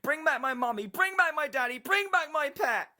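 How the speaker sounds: high, full-force screamed demands; shrieking child voices, very loud; children screaming. A narrator's reading. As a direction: scream like desperate children, drawing out the last one. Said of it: "They were screaming".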